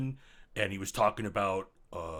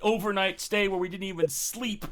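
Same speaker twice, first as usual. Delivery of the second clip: loud, higher-pitched fed-up tirade; exasperated rant; 6 semitones higher, 7 dB louder than usual. Acted. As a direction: rant loudly about being cheated out of a night. Fed-up rant about the costly night.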